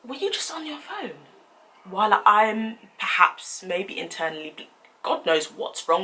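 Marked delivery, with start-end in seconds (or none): 0.0-1.3 incredulous question. Retold or imitated. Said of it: "And I was like".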